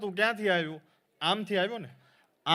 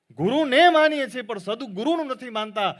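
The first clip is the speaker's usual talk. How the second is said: ગુરુને માનીએ છીએ called out louder and higher; emphatic repeat; 9 semitones higher, 9 dB louder than usual. Shown as a demonstration. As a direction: hammer the point home to the crowd. (ભાર દઈને મોટેથી)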